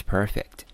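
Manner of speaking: "perfect" slowly, the c stopped dead before the t; abruptly stopped consonant. Shown as a demonstration. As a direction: break "perfect" in two, halting on the c. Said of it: "戛然而止啊". (perfect 的 c 戛然而止)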